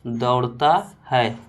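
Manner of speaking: drawn out word by word; slow dictation. Shown as a demonstration. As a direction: dictate each word slowly. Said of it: "इसी प्रकार से बोले". (धीरे-धीरे लिखवाना)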